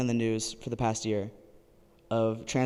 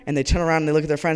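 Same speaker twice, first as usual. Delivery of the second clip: usual speaking voice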